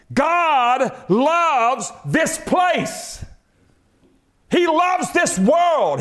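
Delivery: slow, high, shouted preaching on love; preaching at full force. Acted. Preacher to congregation on God's love, fervent=45. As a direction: lift every word to the rafters.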